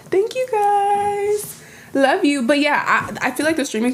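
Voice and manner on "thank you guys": squeaky grateful thank-you; high cute thanks. Real, not acted. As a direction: gush it up high and sweet. Thanking chat donors on stream.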